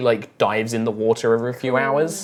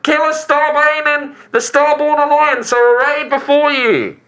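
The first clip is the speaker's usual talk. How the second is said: shopkeeper's sales pitch; 4 dB louder than usual. Acted. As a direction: present the wares with ceremony, pitched up. Voices the shopkeeper.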